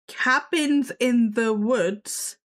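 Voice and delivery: ordinary talk, nothing marked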